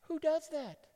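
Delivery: high-pitched incredulous mock reaction; acted scandalized onlooker; baffled disbelief. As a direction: squeak it out, appalled at the cheek. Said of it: "And they're like".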